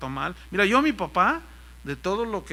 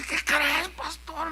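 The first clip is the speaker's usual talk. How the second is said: high-pitched tearful voice; retold tearful cry, pitched way up; imitated crying; 9 semitones higher, much slower than usual. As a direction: mimic someone weeping with news. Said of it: "llorando me dice".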